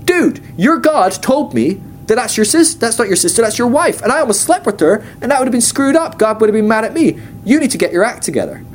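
hammed-up indignant rebuke, mild; mock angry Pharaoh. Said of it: "he yells at Abraham".

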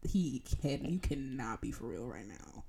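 slow, low and quiet disbelief; deadpan disbelief. Retold or imitated. Student retells their reaction to a classmate acting wild, deadpan disbelief. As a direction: let it sink slowly, unimpressed. Voices own disbelieving look.

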